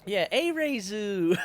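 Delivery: Arezu in a slow, drawn-out Midwest accent. Shown as a demonstration. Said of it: "my Midwest accent coming out".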